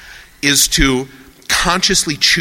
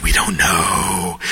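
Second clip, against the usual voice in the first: low, slow confession; weary admission; 6 semitones lower, slower than usual. Acted. Physicists confessing the real answer, low slow admission. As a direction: admit it slowly, pitched down. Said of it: "They know, like, the real answer".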